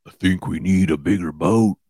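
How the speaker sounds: flat deadpan Jaws line; mild imitation of the stepdad quoting; lightly done movie-quote voice. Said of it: "he just does movie quotes".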